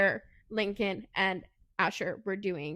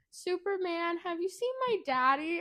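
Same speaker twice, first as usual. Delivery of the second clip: put-on little girl's voice; 7 semitones higher than usual. Voicing little girl in story.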